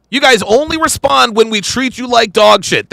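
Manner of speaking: usual speaking voice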